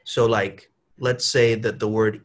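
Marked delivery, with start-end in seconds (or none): none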